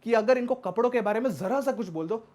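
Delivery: usual speaking voice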